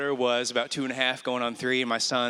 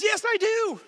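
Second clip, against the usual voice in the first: put-on very high, insistent little boy; child insisting to sister; indignant child. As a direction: protest like an upset toddler. Son insisting in retold story.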